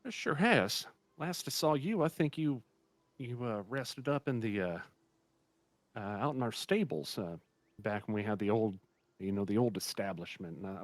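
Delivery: put-on old innkeeper welcoming a returning guest. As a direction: greet an old friend cheerfully. Innkeeper voice held.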